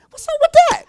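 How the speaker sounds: very high, loud comic squeal; hammed-up falsetto complaint; squeaky protest. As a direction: squeal it in baffled mock outrage. Acts disciple's scared protest.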